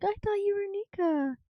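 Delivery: put-on high, soft anime girl; soft, high-pitched anime dub voice; anime character voice. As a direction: play her small and high-pitched. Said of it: "I could, like, hear, like, in the anime".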